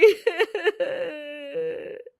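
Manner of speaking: high sob falling away; trailing sob. Acted. Ritu ending her plea with a falling sob. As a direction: let a sob fade out. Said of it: "जोर-जोर से रोने लगती है".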